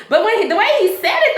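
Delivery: ordinary talk, nothing marked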